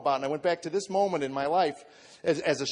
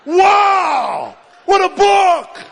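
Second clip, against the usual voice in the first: very high, full-volume yell of amazement; excited shouting; 15 dB louder, much slower than usual. Retold or imitated. New reader yelling from his front lawn, excited shout. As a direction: holler it across the front lawn. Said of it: "run out in my front lawn and go".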